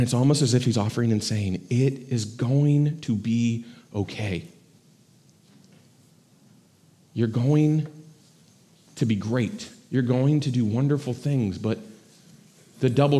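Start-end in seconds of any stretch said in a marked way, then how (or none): 1.7-11.6 soft, tender promise that all will be well; gentle reassurance. Acted. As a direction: comfort him gently, like a parent. God's reassurance voiced to Elisha.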